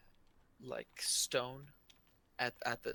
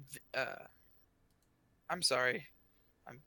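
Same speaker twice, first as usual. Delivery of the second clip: usual speaking voice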